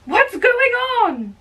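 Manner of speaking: high, startled question; alarmed question. Acted. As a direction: burst in asking what's happening. Arriving on the brawl.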